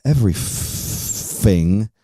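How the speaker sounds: "Everything" drawn out slowly with an F for the th, London style. Shown as a demonstration. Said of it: "with an F".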